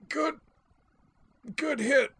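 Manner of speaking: strained high wheeze after a gut punch; winded croak. Acted. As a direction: force the words out with no breath left. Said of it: "I managed to croak".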